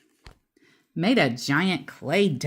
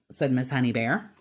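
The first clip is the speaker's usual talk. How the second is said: ordinary delivery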